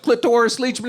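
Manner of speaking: high, excited blurt; blurted-out shout. Retold or imitated. Kid blurts his answer to a dinner guest, high shout. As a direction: call the name out high and proud.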